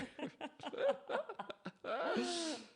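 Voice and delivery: real laugh breaking out; high laugh. Real, not acted. Laughing with the host about the prom rental suits. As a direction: burst of high-pitched laughter.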